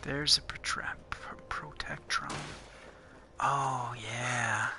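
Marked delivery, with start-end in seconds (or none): 0.0-2.3 whispering. Real, not acted. Sneaking past a protectron robot.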